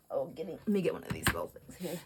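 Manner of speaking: unvoiced murmured aside to herself; whispered aside. Real, not acted. Crafting on camera, reaching for a tool, whispered aside. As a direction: whisper it while reaching for supplies.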